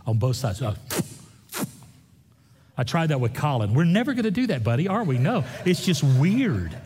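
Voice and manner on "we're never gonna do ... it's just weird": ordinary talk, nothing marked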